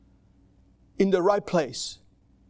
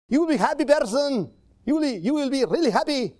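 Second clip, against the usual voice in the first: mock prosperity preacher; 7 semitones higher than usual. Mocking false preachers to his congregation, mock-cheerful promise. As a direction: parody a preacher selling an easy life.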